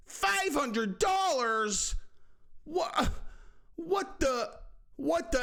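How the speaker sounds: high, slow, stunned; shocked disbelief. Real, not acted. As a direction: gasp it out in disbelief. Shocked at the fine.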